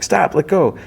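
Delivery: retold panicked yell. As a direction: shout it to make her stop. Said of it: "Lily yells at her".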